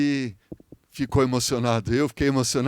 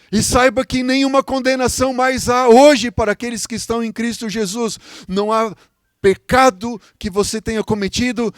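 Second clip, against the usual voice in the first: loud, high altar-call exhortation; pregação a plena força; 7 semitones higher, 7 dB louder than usual. Real, not acted. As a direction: cry out each appeal to the congregation. Preaches at full force. (apelo fervoroso, alto e agudo)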